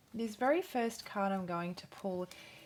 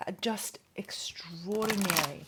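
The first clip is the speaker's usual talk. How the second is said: whispered. Real, not acted. Reader whispers Capricorn's energy to viewers, hushed whisper. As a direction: hush it like a secret.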